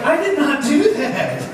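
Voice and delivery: high, drawn-out protest; indignant disbelief. Retold or imitated. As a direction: protest in mock horror, stretching the words. Acted shocked reaction to playback.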